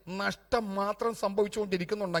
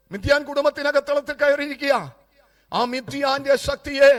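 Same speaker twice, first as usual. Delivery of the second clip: high, raised preaching voice; forceful preaching; 6 semitones higher, 9 dB louder than usual. Acted. Preacher denouncing Midian's power inside the family, forceful preaching. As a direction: declare it against the enemy.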